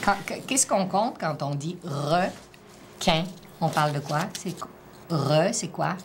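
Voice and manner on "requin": "requin" broken in two with a clear gap; "requin" as two separate beats; syllable split. Shown as a demonstration. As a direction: say "requin" slowly, one syllable at a time. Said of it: "dans la première syllabe ou dans la deuxième".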